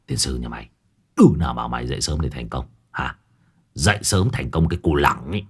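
low, scornful rebuttal; Hải nghẹo rebutting. Acted. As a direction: sneer at the kid's naive idea. (Hải nghẹo bác bỏ, giọng trầm khinh khỉnh)

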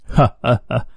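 short soft falling laugh; a quiet mocking chuckle down the line; sinister laugh. A narrator's reading. Kidnapper scoffing at the father on the phone, soft mocking laugh.

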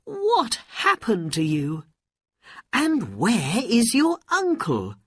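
high, loud anxious questions; mother's urgent, high-pitched questioning; crying out. A narrator's reading. Mother asking returned Aladdin what happened, crying out. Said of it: "she cried".

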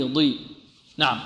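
usual speaking voice

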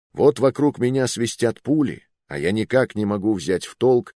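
plain reading voice

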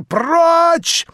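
piercing high scream. A narrator's reading. Natasha screaming at the prince to get out. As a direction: fling the word at him. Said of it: "закричала Наташа".

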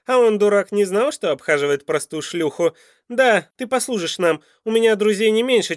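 loud, high, jeering tirade; scornful villain. A narrator's reading. Captor insults the pleading woman, loud and scornful. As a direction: lay into her with loud contempt.

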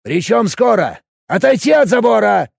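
loud, high urgent order; shouted command. Acted. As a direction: shout them back from the fence. Commanding villagers before attack. (громкий приказ)

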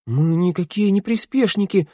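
high squeaking voice; squeaky protest. A narrator's reading. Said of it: "запищала Санда". (писклявый голосок)

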